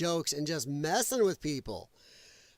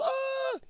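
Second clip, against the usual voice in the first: mock shriek. Mocking outraged critics.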